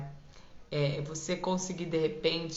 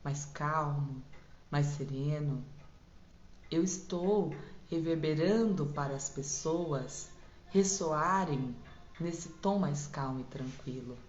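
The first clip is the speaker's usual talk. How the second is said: softer, low and soothing; calm soft voice; 3 semitones lower, 6 dB quieter than usual. Shown as a demonstration. Coach demonstrating calm tone to listeners, soothing. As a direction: settle the listener's heart. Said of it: "se eu falo num tom mais baixinho".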